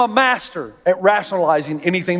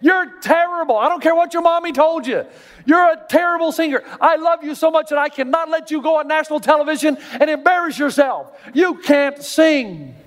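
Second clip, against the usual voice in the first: blunt friend yelling; 10 semitones higher than usual. Retold or imitated. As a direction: yell it at him so he finally hears. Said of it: "the friend that will say".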